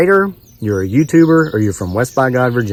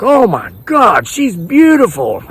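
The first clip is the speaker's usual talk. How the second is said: very high, slow, imitated swooning; mock amazed gushing; 12 semitones higher, 4 dB louder, slower than usual. Others jumping up to see the photo, hammed-up amazement. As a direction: imitate onlookers gasping in admiration, way up high. Onlookers' amazed reaction, retold.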